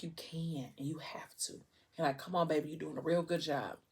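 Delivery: very soft, tender coaxing; retold partner's hushed reassurance; gentle encouragement. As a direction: quietly reassure her she's doing well. Partner coaxes mother to push.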